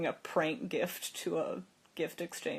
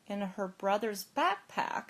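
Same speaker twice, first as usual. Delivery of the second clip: pondering to oneself; 4 semitones higher, 4 dB louder than usual. Retold or imitated. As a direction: mull it over aloud, with a pause midway. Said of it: "I thought for a second".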